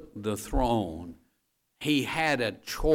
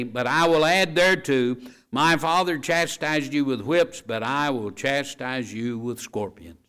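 scripture reading; 4 dB louder than usual. Acted. Preacher reading the king's harsh answer aloud to church. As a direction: proclaim the verses to the congregation.

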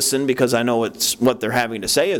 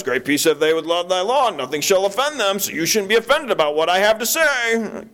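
mock self-righteous; 7 semitones higher than usual. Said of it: "free to say whatever we want".

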